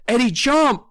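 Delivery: high-pitched shouted command; urgent cry. A narrator's reading. Narrator warning Eddie of charging rats, urgent cry. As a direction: yell to make them move now. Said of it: "I cried".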